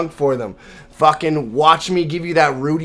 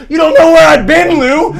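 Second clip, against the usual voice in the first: mock movie yell; 10 semitones higher, 8 dB louder than usual. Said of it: "bleeds on him and goes".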